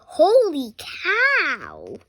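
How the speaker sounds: slow, loud, stretched holy cow; amazed. Acted. Child reader amazed at the flying hero, slow and loud. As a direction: drag out the amazement.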